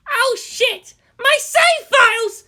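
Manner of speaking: put-on panicked boy. Boy panics over broken game.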